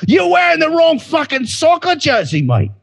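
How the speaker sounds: high-pitched Cockney football hooligan; hammed-up English yob's challenge; rough British accent. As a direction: pick a fight as a caricature British soccer thug. Acted British football hooligan threatening a visitor.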